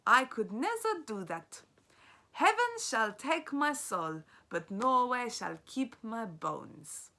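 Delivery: put-on a grave vow in a loose Norwegian accent. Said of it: "This is what it kind of sounded like".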